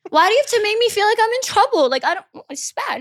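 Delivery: high, fast, whiny hurt; put-on near-tears complaint; mock tearful protest. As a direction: plead as if about to cry, hammed up. Acts being yelled at, near tears.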